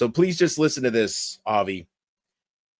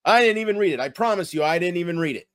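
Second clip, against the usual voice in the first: ordinary talk, nothing marked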